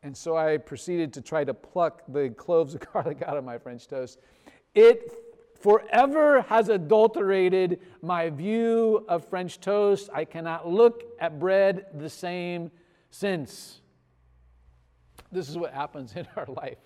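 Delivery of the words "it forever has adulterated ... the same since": loud, high, slow proclamation; hammed-up aggrieved outcry; melodramatic lament. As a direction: declare it as a huge personal tragedy. Comic lament over ruined French toast.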